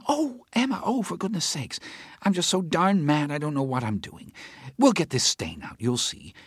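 a worked-up friend ranting about the case; Teresa's agitated voice. Acted. Teresa rants to Howard about the judge, agitated.

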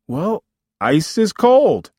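high, loud, slow retort; exasperated retort. Acted. Son to complaining mother, exasperated retort. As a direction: spell out the obvious, stretched and loud. Son argues back about ice.

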